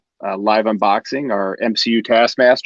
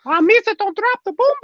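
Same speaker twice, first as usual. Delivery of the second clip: very high goofy character impression; bad, very high-pitched character voice; Jar Jar. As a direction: squeal it like a silly alien sidekick. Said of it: "It's my bad Jar Jar impression".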